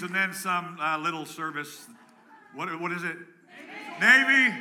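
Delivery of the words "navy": much higher, loud call. Real, not acted. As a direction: belt out the name. Calling out the branches.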